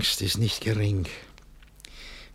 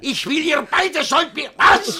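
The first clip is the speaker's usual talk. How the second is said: very high, loud furious was; sputtering shout; 9 dB louder than usual. Acted. Master interrupted, screaming was at the servants. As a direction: explode at them.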